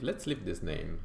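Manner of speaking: usual speaking voice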